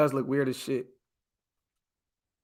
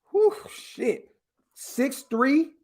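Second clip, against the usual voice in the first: high, excited whew of amazement; a pitched-up gasp of awe; impressed whistle; 8 semitones higher, much slower than usual. Real, not acted. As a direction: blown away by the numbers. Impressed by prospect's size.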